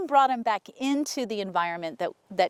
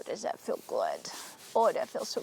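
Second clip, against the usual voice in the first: low, hushed and tender; soft cooing to horse; 3 semitones lower, 7 dB quieter than usual. Real, not acted. Trainer to her horse, asking if the stroking feels good, soft and low. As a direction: murmur it softly to the horse as you stroke him.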